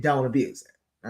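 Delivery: ordinary delivery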